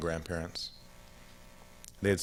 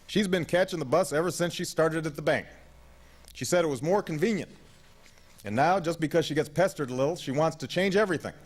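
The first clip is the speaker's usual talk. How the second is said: put-on high-pitched, aggrieved old man; complaining grandfather; 8 semitones higher than usual. A narrator's reading. Gramps complaining about his wife to grandson, aggrieved. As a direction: grumble on in a raised pitch, put out.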